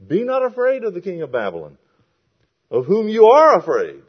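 high, loud proclamation of the verse; declaimed scripture. Acted. Preacher quotes God on the king of Babylon, loudly declaimed.

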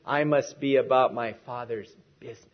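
low, even run up to a set-apart "business"; stress on BUSINESS. Shown as a demonstration. Preacher giving the last emphasis, weight on "business". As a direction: save the weight for "business" at the end. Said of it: "And the last one is".

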